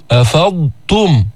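أفضتم slowly, the ض sounded before the ت; careful tajweed; clear ḍād. Shown as a demonstration. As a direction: separate the ض from the ت. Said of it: "بتبين التاء بعد".

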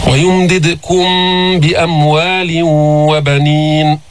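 slow, measured tajweed recitation; steady melodic Quranic chant. Shown as a demonstration. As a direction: chant the verse with long, held vowels.

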